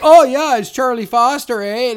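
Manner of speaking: high, loud Canadian hoser; cartoon hoser accent. Co-host imitating the film's car dealership scene, exaggerated hoser accent. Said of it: "hoser type accent".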